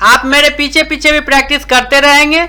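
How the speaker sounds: usual speaking voice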